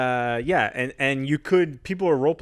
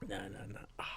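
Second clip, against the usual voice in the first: very soft, lowered murmur; whispering; 7 semitones lower, 23 dB quieter, faster than usual. Real, not acted. Man hit in the nuts waving off an apology, whispering. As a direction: drop to a near whisper. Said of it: "why are you whispering?".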